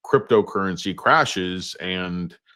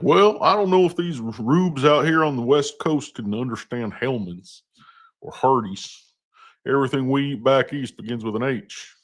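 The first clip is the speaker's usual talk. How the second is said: higher, mocking slack-jawed East Coast accent; 5 semitones higher than usual. East Coast guy sneering at West Coast rubes, slack-jawed East Coast accent. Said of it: "the East Coast guy has some kind of slack-jawed accent".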